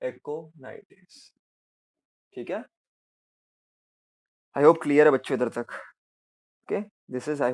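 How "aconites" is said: aconites stretched very slowly and much softer; slow dictation. Shown as a demonstration. Teacher drawing out term. (धीमे और धीरे बोलना)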